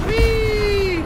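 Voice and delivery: high, loud joyful squeal; a delighted rollercoaster whee; gleeful whee. Real, not acted. Pilot whooping as the ship swoops down.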